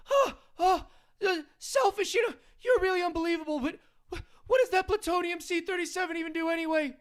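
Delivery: squeaky sidekick, indignant and yelling; Morty impression. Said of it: "doing a Rick and Morty impression".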